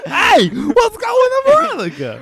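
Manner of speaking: high, very slow yell; shouting. Pretend. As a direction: shout the greeting, drawn out. Mock greeting shouted at answer.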